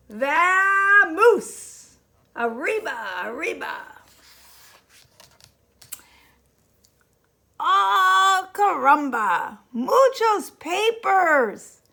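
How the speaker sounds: loud high hammed-up Spanish accent; rally the troops in a comic Spanish accent; mock Spanish shout. A narrator's reading. Chinchetta's Spanish-flavoured rallying cry.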